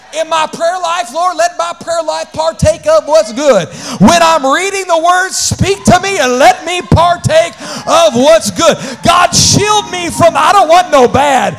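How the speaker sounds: loud, climbing prayer shout from the pulpit; full-force preaching. Acted. Pastor praying aloud over the congregation, preaching at full force. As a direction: cry it out to the Lord, building the fire.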